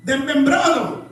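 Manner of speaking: high-pitched, driving sermon delivery; predicación enérgica. Acted. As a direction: preach it hard, pushing the pitch up. Preaches at full force. (prédica encendida)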